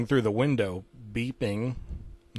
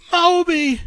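very high, loud scream of a name; 9 dB louder than usual. Acted. Tim watching Moby disintegrate, loud high scream. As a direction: shriek his name at full force. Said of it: "screamed Tim".

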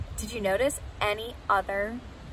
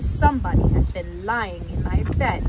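loud father bear, not amused; lightly acted displeased cry; Papa Bear; 2 semitones lower, 10 dB louder than usual. Papa Bear finds his bed used, loud and not amused. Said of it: "he cried, and he was not amused".

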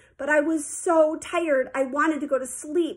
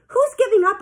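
indignant retort, higher and louder; 8 semitones higher, 6 dB louder than usual. A narrator's reading. Sheila objecting to Marty pushing her, indignant. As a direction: throw the question back, affronted. Girl challenged by coach.

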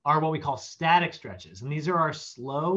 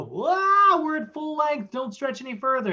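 squeaky, much higher alarmed cry; a tiny sensor shrieking a warning; panicked high voice; 11 semitones higher, 5 dB louder than usual. Acted. The spindles warning the brain in a squeaky panicked voice. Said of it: "they both go".

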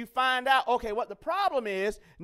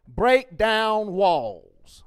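slow emphatic slogan; 7 dB louder, much slower than usual. Shown as a demonstration. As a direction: Break down walls drawn out very slowly, each word hammered. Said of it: "Or you can say it like this".

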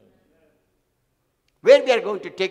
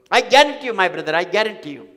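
loud assurance; 7 dB louder than usual. Acted. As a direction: declare it with force. Preacher promising congregation.

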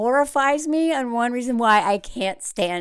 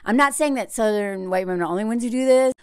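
ordinary talk

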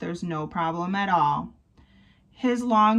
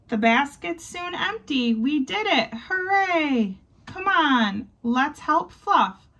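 higher, excited cheer of success; 6 semitones higher than usual. Acted. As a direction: celebrate the job done. Cheering after saving Easter.

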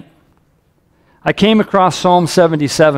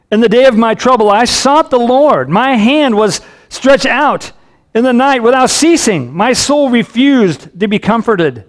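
psalmist's distress; 6 semitones higher than usual. Acted. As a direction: read the psalm as a cry to God. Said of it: "the distress on his, on his voice".

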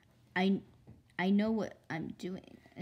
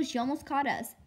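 ordinary delivery

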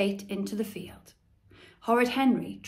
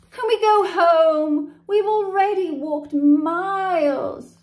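high, loud, drawn-out whinge; 8 semitones higher, 9 dB louder than usual. A narrator's reading. Henry whinges to his parents to go home, loud and high. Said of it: "whinged Henry".

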